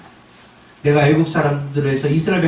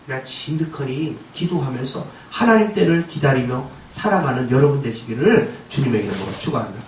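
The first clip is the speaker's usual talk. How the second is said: ordinary talk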